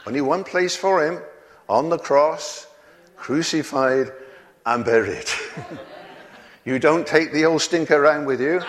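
normal talking voice

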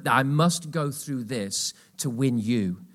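ordinary delivery

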